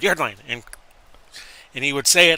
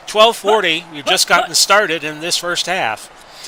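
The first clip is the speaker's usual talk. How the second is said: louder, quicker sports call as the play unfolds; 3 semitones higher, 6 dB louder than usual. Acted. Announcer to viewers, live play-by-play.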